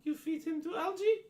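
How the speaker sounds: high, questioning frog-man voice. Acted. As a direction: ask as a high frog creature.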